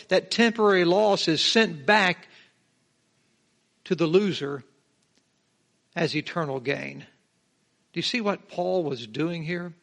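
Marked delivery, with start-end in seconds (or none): none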